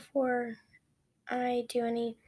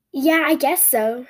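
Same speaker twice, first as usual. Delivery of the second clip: energetic again; 8 dB louder, slower than usual. A narrator's reading. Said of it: "back in her usual voice".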